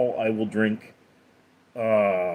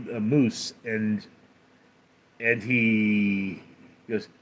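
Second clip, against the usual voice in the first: normal talking voice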